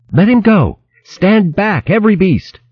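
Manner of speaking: high, urgent shout to stand back; urgent warning. Acted. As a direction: warn everyone off. Juka warns everyone away.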